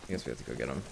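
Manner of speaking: low, soft muttered repeat; muttered echo. Pretend. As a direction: mutter it under your breath. Echoes in muttered voice.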